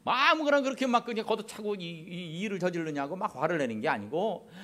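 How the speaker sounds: ordinary talk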